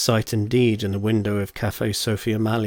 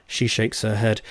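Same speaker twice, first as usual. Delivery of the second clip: ordinary delivery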